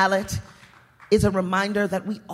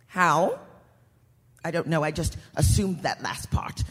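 ordinary talk, nothing marked

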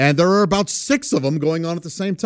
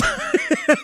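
high squeak of laughter. Real, not acted. Girl ripping worm.

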